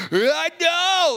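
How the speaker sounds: sudden high, loud burst; inner excitement. Retold or imitated. His inner voice reacting as the shoulder heals, a high loud burst. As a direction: shoot up high and loud like a thrill inside.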